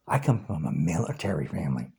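fast, hushed whisper, no voice. Real, not acted. Repeating his military family to Trumpers, whispered. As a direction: breathe it out low and quick.

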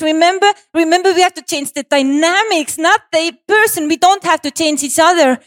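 high, rising panicked begging; desperate pleading. Retold or imitated. Pleading with her boyfriend not to break up, desperate and high.

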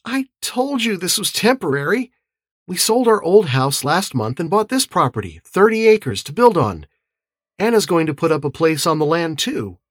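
high-pitched, mildly sour woman. A narrator's reading. Beth tells Lowell the shack is temporary, sour. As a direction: explain it in a high, put-out voice. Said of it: "She said sourly".

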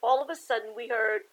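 lively picture-book read-aloud; story narration. A narrator's reading. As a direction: read it to the kids with bounce.

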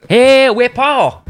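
high, drawn-out Scottish greeting; Scottish accent. Retold or imitated. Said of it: "Jimmy's got his Scottish accent".

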